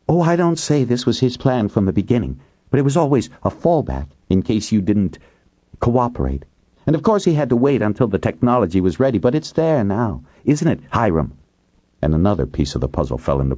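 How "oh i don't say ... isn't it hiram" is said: a touch higher, accusing; lightly voiced woman. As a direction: accuse him calmly. Kate voiced in story.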